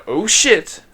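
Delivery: sudden startled outburst. Real, not acted. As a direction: jolt at the surprise.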